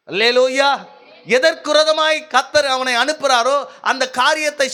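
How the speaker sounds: high-pitched, driving exhortation; full-force preaching. Acted. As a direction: preach it out to the whole congregation.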